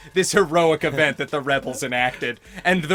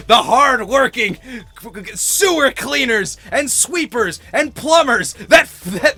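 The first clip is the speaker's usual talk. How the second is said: slow, loud rousing politician voice; rally the working folks like a candidate; hammed-up presidential stump speech; mock campaign speech; 3 semitones higher, 4 dB louder, slower than usual.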